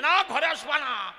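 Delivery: high, loud, drawn-out acted refusal; shouted refusal. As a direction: shout to bar him from the house. Family acted barring the father. (চেঁচিয়ে ঢুকতে বারণ)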